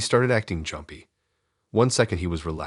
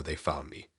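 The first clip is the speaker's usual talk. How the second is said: softer, barely-there retold mutter; a light mock mutter to himself, as if caught; muttered under breath; 9 dB quieter than usual. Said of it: "He started muttering under his breath".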